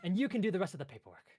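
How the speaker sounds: high, loud, fast little clerk; bossy clerk. Acted. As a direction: rattle off the last order. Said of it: "he walks over towards you".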